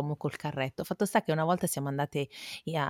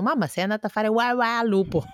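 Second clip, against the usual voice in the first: put-on child voice; 6 semitones higher, 5 dB louder than usual. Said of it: "mi dicono".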